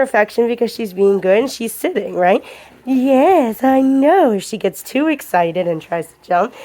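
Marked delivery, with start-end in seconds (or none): 2.8-4.3 soothing the dog. Real, not acted. Petting dog.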